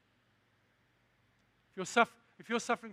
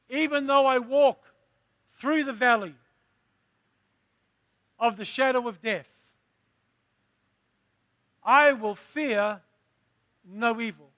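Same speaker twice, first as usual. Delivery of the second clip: preaching; 2 semitones higher, 7 dB louder than usual. Acted. Preaching the psalm at full force.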